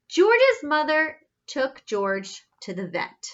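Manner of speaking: ordinary delivery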